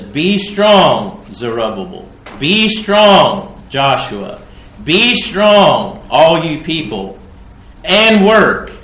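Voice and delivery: loud, high repeated command; thundering command. Acted. God commanding leaders and people to work, thundering. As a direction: drive each be strong home like an order.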